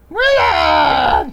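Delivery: high, loud sultry shout; sultry perfume ad. Retold or imitated. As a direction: shriek his name sultrily. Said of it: "her beautiful, sultry voice saying".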